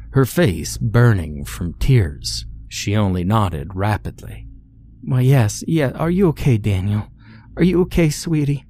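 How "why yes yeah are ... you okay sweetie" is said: high-pitched, flustered concern; worried, much higher-pitched caretaker; anxious woman. Acted. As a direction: fuss over the child in a high woman's voice. Anxious woman fusses over hurt boy.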